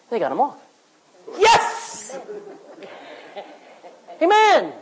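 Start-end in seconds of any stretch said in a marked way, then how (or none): 1.4-2.2 breathy high yes; unvoiced cheer; excited cheer. Real, not acted. Preacher cheers at the story. 4.2-4.7 high, loud amen with a laugh; laughing high shout of agreement; excited amen. Real, not acted. Preacher cheering, congregation agrees.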